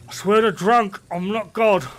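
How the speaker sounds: telling someone dead in the eye he's not drunk, mock drunk; mock drunk slur. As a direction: play a drunk swearing he's sober. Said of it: "and go".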